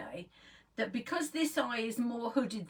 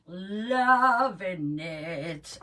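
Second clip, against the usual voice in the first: sarcastic drawl; 8 dB louder, much slower than usual. Real, not acted. After noting unflattering spot.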